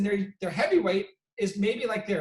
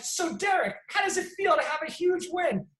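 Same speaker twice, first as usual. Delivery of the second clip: high-pitched hammed-up interviewer voice; mock interviewer; 9 semitones higher than usual. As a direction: play the TV reporter big. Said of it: "They're like".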